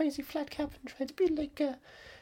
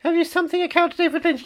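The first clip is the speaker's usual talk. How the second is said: reading back Siri's words, louder; repeating to voice assistant; 6 dB louder than usual. Retold or imitated. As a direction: parrot the assistant's answer. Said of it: "Here's what I found".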